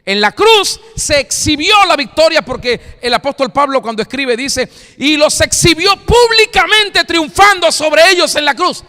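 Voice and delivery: high, loud sermon climax; fiery preaching. Acted. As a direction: preach it out to the congregation. Pastor preaching at peak. (predicación a todo pulmón, aguda y fuerte)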